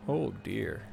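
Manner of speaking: ordinary talk, nothing marked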